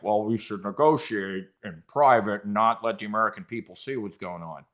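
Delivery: low, fading mock politician voice; mocking McConnell. Host mocks McConnell's plea for secret talks, in a low fading mock voice. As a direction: sneer it out like a sly politician, trailing off. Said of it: "cocaine Mitch McConnell, like".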